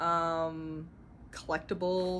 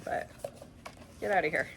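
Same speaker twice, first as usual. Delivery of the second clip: ordinary talk, nothing marked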